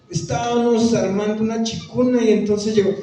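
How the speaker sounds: imitating the nasal rural Tolima accent; a mild mock of the strong Tolima village twang. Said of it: "Habla español gangoso".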